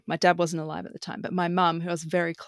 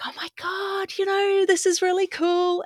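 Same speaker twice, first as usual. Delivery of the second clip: recalled thrill, pitched way up high; giddy excitement; 11 semitones higher than usual. Retold or imitated. Telling a friend about meeting the couple, excited and high. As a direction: gush like a teen meeting friends who get it. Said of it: "I was like".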